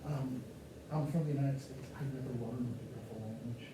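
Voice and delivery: soft, low, apologetic murmur; humble polite request. Shown as a demonstration. Polite tourist softly asking a French person for help. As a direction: shrink down and ask meekly. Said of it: "Here's how you deal with the French".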